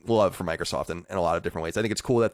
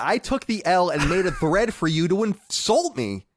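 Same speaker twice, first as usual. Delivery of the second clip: high, mock-wounded plea; mock pleading; 7 semitones higher than usual. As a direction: play the martyr, pitched up. Mock plea about insult thread.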